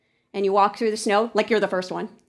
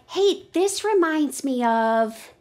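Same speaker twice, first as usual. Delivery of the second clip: thinking voice; 7 semitones higher, slower than usual. Acted. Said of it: "It's like".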